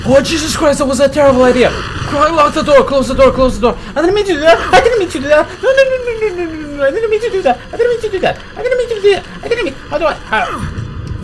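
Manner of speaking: high, loud panicked shrieks; real terror screaming at a zombie; frantic high yelling, half laughing. Real, not acted.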